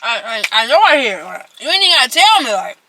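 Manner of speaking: ordinary talk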